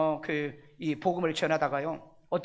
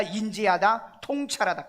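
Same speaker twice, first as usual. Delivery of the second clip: ordinary talk, nothing marked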